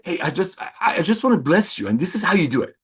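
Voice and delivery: ordinary talk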